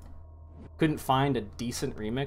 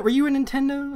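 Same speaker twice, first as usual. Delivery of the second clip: much higher, louder mock-childish question; squeaky teasing voice; 14 semitones higher, 6 dB louder than usual. Asking the young co-caster if he grew up on Nintendo, in a high teasing squeak. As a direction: pitch it up high to tease him about his age.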